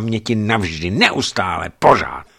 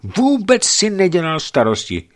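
desperate and wild; 11 semitones higher, 4 dB louder than usual. A narrator's reading. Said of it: "zoufale a divoce zároveň".

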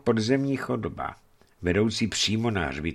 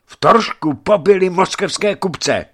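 urgent report; 11 semitones higher, 8 dB louder than usual. Acted. Messenger breathes out dire news.

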